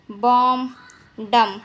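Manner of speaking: normal talking voice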